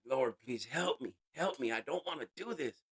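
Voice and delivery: retold desperate prayer, near tears; tearful prayer. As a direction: beg for help like you're crying on a rock. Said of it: "just crying and crying".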